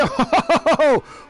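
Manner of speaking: loud high-pitched laughter. Real, not acted. Commentator seeing a car go astray, laughing.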